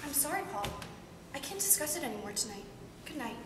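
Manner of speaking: very soft, low voice; subdued withdrawal. Acted. Wife ending the talk with her husband, subdued. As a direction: close the discussion quietly and low.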